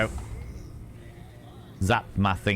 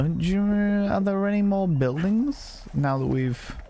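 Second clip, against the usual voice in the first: high voice; 11 semitones higher than usual. Acted. Odd high aside to game.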